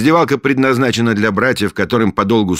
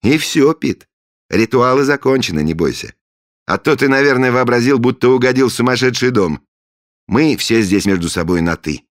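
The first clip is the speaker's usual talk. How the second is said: cheerful; 10 semitones higher, 7 dB louder than usual. Acted. Friendly Nep after ritual.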